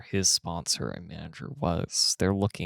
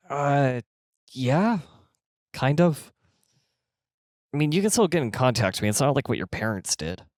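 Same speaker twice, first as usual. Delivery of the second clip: higher, louder, hesitant admission that turns into hurried justifying; somewhat guilty; 6 semitones higher, 5 dB louder than usual. Acted. Manager admitting to his fighter he sold him, guiltily. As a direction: own up awkwardly, then rush to soften it. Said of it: "somewhat guiltily".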